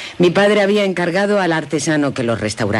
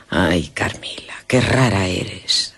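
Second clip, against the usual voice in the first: susurro fascinado; 7 semitones lower, slower than usual. Acted. Laura fascinada por Carmila.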